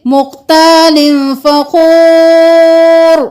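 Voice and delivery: فخور held long, stopping on ر; pausal recitation. Shown as a demonstration. Teacher shows stopping at the verse end. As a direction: stop on فخور, drawing the vowel out. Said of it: "اگر تو ہم یہاں پر وقف کر دیں گے".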